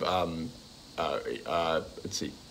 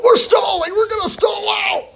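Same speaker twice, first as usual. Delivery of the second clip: imitating Hal yelling; 5 dB louder than usual. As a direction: squawk it like a panicked old man yelling at his wife. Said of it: "sometimes he'll go".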